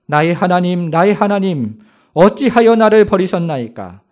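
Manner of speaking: Jesus crying from the cross; loud cry. Acted. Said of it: "크게 소리를 외치기를".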